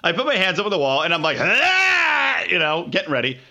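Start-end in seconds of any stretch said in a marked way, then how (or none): 1.4-2.4 high, drawn-out "aah"; acted strained wail before puking; retching groan. Drunk man bracing to puke, strained high cry.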